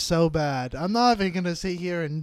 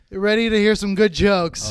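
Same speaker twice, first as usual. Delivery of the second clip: loud stage voice; 3 semitones higher, 8 dB louder than usual. Pretend. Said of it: "comic addressing crowd".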